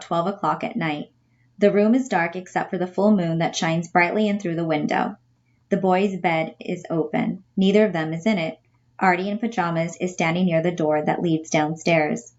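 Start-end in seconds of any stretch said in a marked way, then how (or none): none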